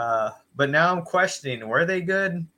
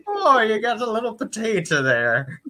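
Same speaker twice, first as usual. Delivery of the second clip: higher hammed-up Irish voice; mock Irish accent; 8 semitones higher, 3 dB louder than usual. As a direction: play a rowdy stage Irishman. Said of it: "With an Irish accent".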